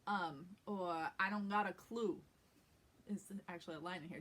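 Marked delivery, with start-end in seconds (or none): 1.2-2.2 New York accent, oo in "clue" pushed up toward the nose; old-school New York gangster-flick accent. Shown as a demonstration. Coach demonstrates a script line, New York accent. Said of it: "It kinda comes right up into the".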